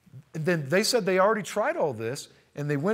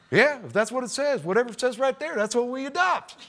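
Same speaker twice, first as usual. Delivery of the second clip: easygoing rural official; 8 semitones higher than usual. Retold or imitated. Building head reassuring the caller, easygoing and high. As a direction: casually agree like it's obvious. County official voiced in story.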